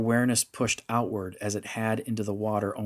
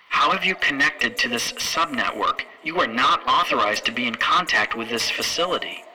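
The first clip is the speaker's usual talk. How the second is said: raised, sharp challenge from an angry matron; 3 semitones higher, 5 dB louder than usual. Acted. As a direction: demand answers, affronted and louder. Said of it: "looked at her with surprise and anger".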